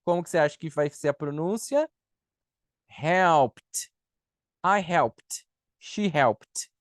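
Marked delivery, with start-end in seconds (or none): 2.9-6.7 "helped" as "helpt", the T overdone; over-articulate the T that ends "helped"; exaggerated final T. Shown as a demonstration. Teacher exaggerating for students, "helped" ending in a popped T. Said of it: "Eu tô exagerando".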